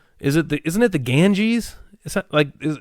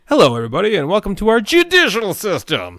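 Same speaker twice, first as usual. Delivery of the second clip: put-on loud, higher slurred drunk voice; drunk Dana Carvey bit; 7 semitones higher, 4 dB louder than usual. Retelling a comedian's bit, slurred drunk voice. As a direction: slur it like a drunk emcee. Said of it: "can't say the word judicial without sounding drunk".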